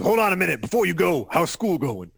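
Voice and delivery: a hint of a tough-guy mentor; Mister T impression. Mister T quizzing a kid about school, lightly voiced. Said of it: "read the lines that Mister T actually says".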